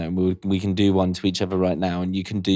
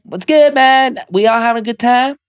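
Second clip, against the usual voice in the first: much higher, louder, hyped bro greeting; hyped voice note; 12 semitones higher, 4 dB louder than usual. Shown as a demonstration. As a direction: boom in like a cheerful mate. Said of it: "I'm a very much like".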